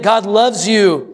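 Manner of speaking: normal talking voice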